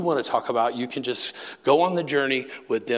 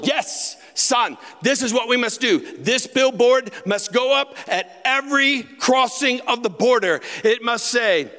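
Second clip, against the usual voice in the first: much higher, louder grand proclamation, slowing down; proclaiming father; 13 semitones higher, 5 dB louder than usual. Retold or imitated. Son hamming up his father reading the billboard slogan, high and loud. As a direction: announce it like a heavenly decree.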